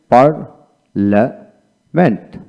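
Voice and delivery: Parliament very slowly in three separated parts; teacher's slow breakdown of parliament; syllable by syllable. Shown as a demonstration. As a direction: break parliament into par, la, ment with pauses. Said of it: "এইভাবে উচ্চারিত হয়".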